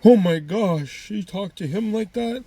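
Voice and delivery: high, drawn-out scandalized gasp; mock shock. Onlooker scandalized at how she talked to him, mock shock.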